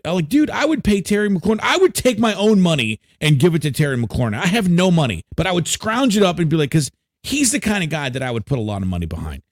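normal talking voice

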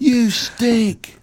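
high-pitched jeer at the performer; hammed-up crowd insult; mock heckler yell. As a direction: playfully heckle from the back row. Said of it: "Somebody just yells".

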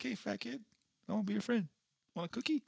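high, soft, sugary approach; mock coaxing. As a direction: coax gently as if befriending a child.

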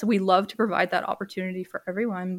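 usual speaking voice

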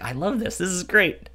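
high, delighted gushing; a giddy fan squealing approval; gleeful fan. Retold or imitated. Viewer gushing about the ambush scene, high and giddy. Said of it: "And it's like".

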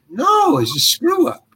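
very high-pitched, incredulous no; emphatic denial. Retold or imitated. Actor correcting fans' theories about the donut, emphatic denial. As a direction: set them straight in a high, exasperated retold voice. Retold answer to curious fans.

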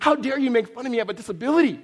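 high, loud feigned outrage; hammed-up indignant protest; mock frustration. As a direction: act wounded and outraged for laughs. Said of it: "now I'm getting frustrated".

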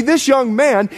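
ordinary talk

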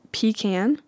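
"pecan" said PEE-can, as in tin can; pronunciation PEE-can. Shown as a demonstration. Vlogger listing options, pecan as PEE-can. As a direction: give "pecan" a long ee and flat can. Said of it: "Do you say".

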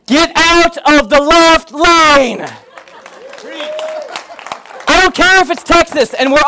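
hammed-up fury at the left lane; mock road rage. Pastor acting out yelling at a slow driver, mock rage.

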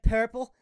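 'Purple' with a PAIR vowel, rising; old childhood purple. Retold or imitated. Asking his cousin to remember, odd-vowelled 'Purple'. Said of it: "Remember, I was like".

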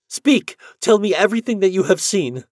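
high, loud urgent plea; wife desperate for news; eager demand. Acted. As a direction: press him for every detail.

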